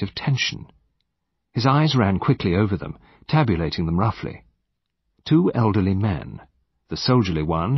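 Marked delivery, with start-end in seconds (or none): none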